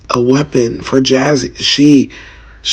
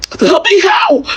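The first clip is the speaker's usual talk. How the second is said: much higher sobbing yell; crying shout; slower than usual. Real, not acted. Begging the world for answers, shouting while crying. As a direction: scream the demand through tears. Grieving rant about child's killing.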